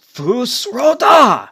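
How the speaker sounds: slow, booming dragon shout; powerful orcish shout. Acted. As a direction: bellow it with full power, drawn out. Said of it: "the powerful orcish voice of just".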